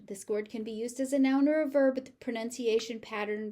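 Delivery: ordinary delivery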